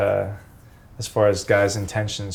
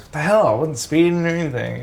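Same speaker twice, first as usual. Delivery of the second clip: higher, indignant what the hell; baffled protest; 5 semitones higher than usual. Retold or imitated. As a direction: sound caught off guard by the cops. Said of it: "And we're just like".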